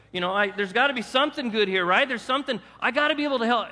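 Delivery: mock self-justifying plea. Mocking self-righteous pleading.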